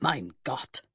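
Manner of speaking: lightly German, low murmured "Mein Gott"; a hint of a German prince, murmured. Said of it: "a voice murmured".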